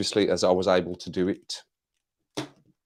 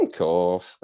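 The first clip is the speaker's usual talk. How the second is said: lightly voiced footballer impression, lower pitch; a hint of a comic's Scouse footballer take; Steven Gerrard; 4 semitones lower than usual. Streamer copies a comedian's footballer act, light impression. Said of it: "I love the Steven Gerrard that Darren Farley does".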